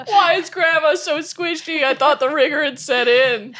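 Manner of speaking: high, loud, laughing silly voice. Acted. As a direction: squeak it out in a goofy voice. Dog mortician's comic line.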